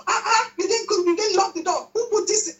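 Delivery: mock-scolding the family as the tired mom; frowning mom. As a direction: mom griping about the messy house, lightly mock-cross. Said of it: "Don't be that mom that is always, like, frowning after work".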